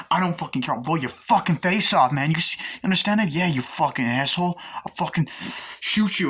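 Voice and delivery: loud, high-pitched acted rage; aggressive threat. Robber threatening to shoot his victim, aggressive threat. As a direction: hurl the threat, hard and loud.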